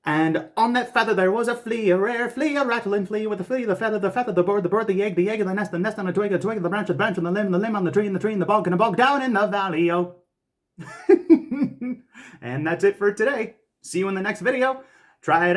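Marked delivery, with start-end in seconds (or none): 0.0-10.2 high, quick, no pause for breath; all in one breath. Shown as a demonstration. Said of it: "try to do it in one breath". 10.8-12.0 high loud relieved laugh; burst of laughter. Real, not acted. Laughs after finishing the twister.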